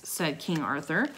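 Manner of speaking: ordinary delivery, nothing marked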